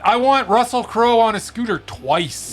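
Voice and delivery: high, eager and slow; excited. Real, not acted. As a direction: gush about what you want. Excited rant about scooter.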